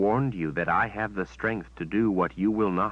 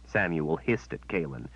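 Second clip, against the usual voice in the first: plain reading voice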